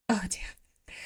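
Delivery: whispered oh damn, swooning; breathless whisper. Pretend. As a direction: breathe it out in awe. Swooning reaction to romance quote.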